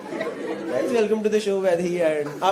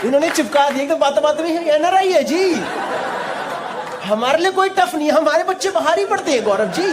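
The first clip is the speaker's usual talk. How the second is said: higher, showy proud-parent voice; mock bragging parent; 6 semitones higher than usual. Host mimicking a bragging dad, mock proud parent voice. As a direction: mimic the father showing off.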